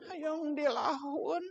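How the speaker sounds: soft, slow, high little voice; drawn-out, hushed childlike request; acted child's plea. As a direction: play a small child asking to be held. Child asks to be held on lap.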